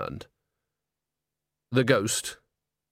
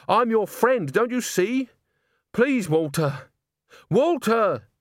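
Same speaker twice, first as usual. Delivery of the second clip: high, urgent appeal rising to a call; plead, then cry out the name; desperate pleading; 10 semitones higher, slower than usual. A narrator's reading. Agnes pleads as ghost advances.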